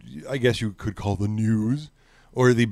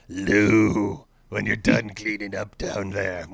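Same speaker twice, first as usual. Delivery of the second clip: Roger Ailes impression; 4 semitones lower, much slower than usual. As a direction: put on a slow, lowered old-boss voice. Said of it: "should have done my Ailes impression".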